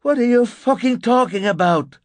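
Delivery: high, loud, furious demand; angry shout. A narrator's reading. Narrator advances on the woman, furious shout. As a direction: explode at her.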